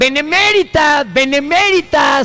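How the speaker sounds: benemérita drawn out slowly, high and mocking; mocking mispronunciation. Host mocks the deputy's reading: slow, high benemérita. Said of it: "de la benemérita".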